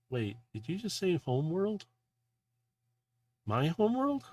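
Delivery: incredulous, pitch climbing; startled disbelief. Acted. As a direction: voice shoots up into a question. Character startled by news of homeworld.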